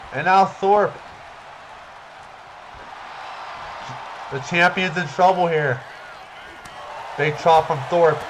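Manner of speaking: excited ringside play-by-play; wrestling commentator. Acted. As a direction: hype every move like live TV.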